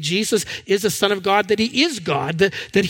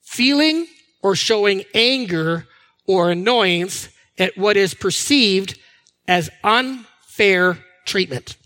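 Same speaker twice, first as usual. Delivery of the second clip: slow, weighty dictionary read; unhurried reading of a definition; 2 semitones lower, slower than usual. Shown as a demonstration. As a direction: read the definition slowly and deliberately. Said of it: "here's the definition to indignant".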